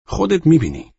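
high, loud dismissive voice; boastful friend. A narrator's reading. Friend boasting to his timid guest, high cocky voice.

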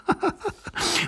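high, airy mocking laugh. Young man laughs at the other gentleman, mockingly. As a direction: laugh in his face.

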